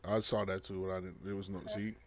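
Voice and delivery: usual speaking voice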